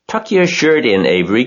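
high, loud fussing; fussing call. A narrator's reading. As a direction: scold the boy from across the crowd. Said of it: "cried Missus Zuckerman".

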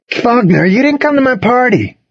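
high, stretched-out accusation; accusing. A narrator's reading. Jimmy to a friend who skipped his party, accusing. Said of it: "Jimmy accused".

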